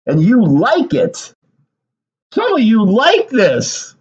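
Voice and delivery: high, drawn-out disbelief; incredulous outburst. Real, not acted.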